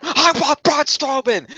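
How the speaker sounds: high, slowed, insistent mock demand; demanding Sami. Sami answers Foley's challenge, high and slowed. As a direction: plant your feet and insist.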